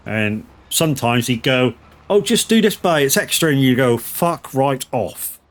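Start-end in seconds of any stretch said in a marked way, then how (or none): 2.1-3.5 much higher, breezy mock farmer voice; imitating the boss. Farmer voiced asking extra work. 4.0-5.4 slow, dragged-out mock dismissal; slow retort. Retort to farmer's request.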